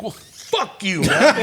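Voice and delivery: slow, laughing, swelling loud at the end; slow laughing insult. Retold or imitated. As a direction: drag it out then laugh. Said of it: "I'm like".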